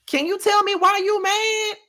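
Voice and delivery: put-on shouted high girl what in disbelief; incredulous reaction. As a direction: act stunned and baffled, pitch way up. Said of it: "And I'm just like".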